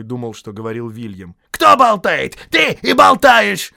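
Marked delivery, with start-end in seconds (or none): 1.5-3.7 high shouted troll accusation; furious quarrel. A narrator's reading. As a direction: shout the blame back at him.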